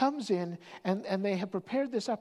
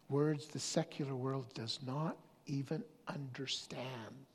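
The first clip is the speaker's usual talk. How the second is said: whisper; 3 semitones lower, 5 dB quieter than usual. Acted. Sermon point, hushed.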